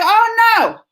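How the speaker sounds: high, outraged oh no; mother refusing to accept it; indignant refusal. Retold or imitated. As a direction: refuse it flat out. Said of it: "Oh, I was mad".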